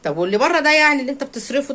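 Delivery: high, fast sarcastic jabs; sarcastic mocking. Real, not acted. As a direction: needle him with sarcasm, quickly. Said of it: "speaker mocks husband's spending outside". (سخرية سريعة وبصوت عالي)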